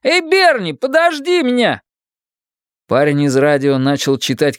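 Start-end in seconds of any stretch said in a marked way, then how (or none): 0.0-1.8 shouting. Acted. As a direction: call out desperately to a friend. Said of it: "крикнул Кори".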